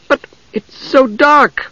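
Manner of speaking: high, slow trembling voice; shaking voice. A narrator's reading. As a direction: say it trembling with fear. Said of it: "her voice shaking".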